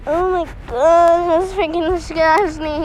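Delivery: slow, loud, grossed out; loud disgust. Real, not acted. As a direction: drag it out in disgust. After swallowing bug, louder take.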